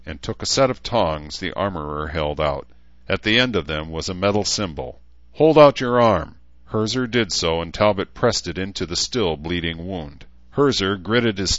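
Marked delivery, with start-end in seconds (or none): 5.3-6.4 loud, higher-pitched order; firm command. Acted. Talbot readying Herzer for the brand, loud firm command. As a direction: command it with ceremonial force.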